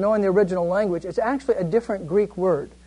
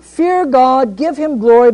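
louder, higher angelic proclamation; 4 semitones higher, 7 dB louder, slower than usual. Shown as a demonstration. Teacher voicing the angel's loud cry to the class, proclaiming. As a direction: call it out over the crowd. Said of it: "a loud voice".

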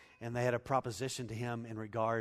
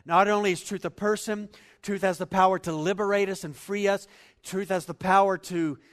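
loud, higher impassioned preaching; preaching at full force; 7 semitones higher, 14 dB louder than usual. Acted. Preacher proclaiming truth's power to congregation, loud and fervent. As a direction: drive it home with fervor.

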